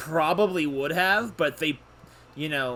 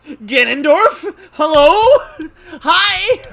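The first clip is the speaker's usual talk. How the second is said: high, very loud disbelief; real shrieked astonishment, pitched way up; amazed shouting; 12 semitones higher, 16 dB louder, much slower than usual. Real, not acted. Player mistaking the judge for Ganondorf, shouting amazed.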